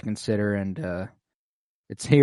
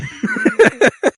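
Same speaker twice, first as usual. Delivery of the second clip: high squealing laugh that breaks out; burst of laughter; 9 dB louder than usual. Real, not acted. Laughing at his own retelling, a real high burst of laughter. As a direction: crack up at your own joke.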